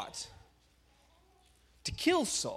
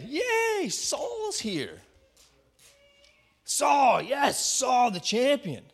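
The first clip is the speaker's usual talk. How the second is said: cheering crowd; 6 semitones higher, much slower than usual. Retold or imitated. Synagogue crowd hailing Saul, shouting excitedly. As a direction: cheer him in like a champion.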